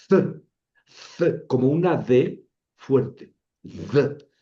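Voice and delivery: th hissed through the teeth like θ. Shown as a demonstration. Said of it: "Es la T silbada". (th silbada entre los dientes)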